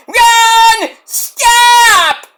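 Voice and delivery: very high, loud mock screech; Sammy Sweetheart. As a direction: screech it like a reality-show caricature. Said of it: "that impression".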